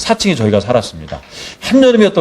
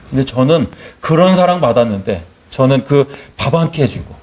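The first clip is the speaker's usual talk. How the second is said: ordinary delivery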